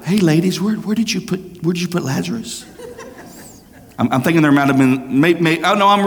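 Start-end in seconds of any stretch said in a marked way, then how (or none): 0.0-2.7 mock breezy tone, softer and quicker; casual offhand question. Jesus asking the ladies where Lazarus is, in a mock offhand voice. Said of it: "I don't think that was a".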